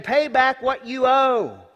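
loud, high, slow threat; angry creditor grabbing his debtor; harsh demand. Acted. Acted choking creditor demand.

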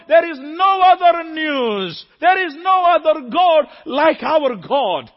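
slow, high, loud preacher's declaration; preaching at full force. Acted. Preacher to congregation, slow loud declaration. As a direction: hammer each word home slowly.

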